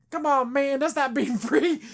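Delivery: high, loud, laughing mockery; mock hype man. Boyd scoffing at John, high, loud and laughing. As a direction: ham up a scoffing buddy. Boyd mocks the trusting moment.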